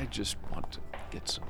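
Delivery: complaining in a hushed, voiceless whisper; whispered grumble. Acted. Chuck complaining aside about wanting decent candy, whispering. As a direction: grumble it under your breath.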